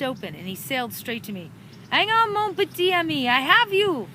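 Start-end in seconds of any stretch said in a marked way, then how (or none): 1.9-4.1 put-on loud French-accented rescue call; French accent yell. A narrator's reading. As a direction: shout it in a thick French accent. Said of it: "he yelled with a thick French accent".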